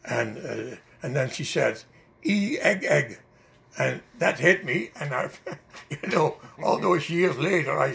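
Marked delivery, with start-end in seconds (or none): 2.2-3.2 higher-pitched mock French-Canadian accent; lightly mimicked French accent. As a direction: imitate a waitress's thick French accent. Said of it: "her French accent was thicker".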